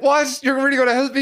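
can't believe he won't shoot; amazed disbelief. Retold or imitated. Wounded player amazed at scav's kindness, surprised. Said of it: "I was like".

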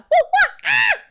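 very loud, high monkey shrieks; monkey whoops. Shown as a demonstration. Guest shows how to free up the voice, monkey shrieks. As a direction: whoop like a monkey, letting the voice loose. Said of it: "just free up the voice".

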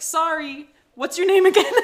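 mock Jack forgetting her name; clueless Jack. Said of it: "He's like".

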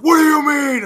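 loud, high disbelief; shocked shout. Retold or imitated. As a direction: yell it in shock. Shocked GM on phone.

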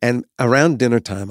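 ordinary talk

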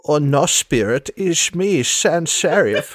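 put-on theatrical accented villainess with slushy s sounds; Sans Serif. Revealing herself to an old friend, theatrical accented voice.

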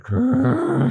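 high loud mock growl; hammed-up angry grr; cartoon growl. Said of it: "smoke coming out of her ears".

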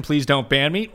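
ordinary talk

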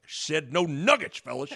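soft, slurred scolding coach; Coach Altman. Retold or imitated. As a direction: imitate a coach quietly grumbling, fading. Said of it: "he was just like".

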